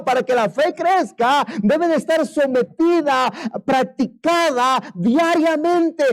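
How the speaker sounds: high, loud sermon exhortation; fiery preaching. Acted. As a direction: press the congregation hard. Preaching at full force. (predicación enérgica y fuerte)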